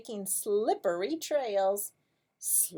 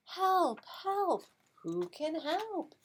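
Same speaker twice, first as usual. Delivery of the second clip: duck calling for help; 9 semitones higher, slower than usual. Acted.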